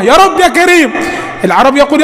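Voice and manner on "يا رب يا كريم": high raised call; echoing shout. Retold or imitated. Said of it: "سيدنا النبي وراه".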